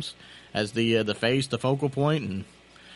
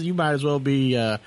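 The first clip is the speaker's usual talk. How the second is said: ordinary talk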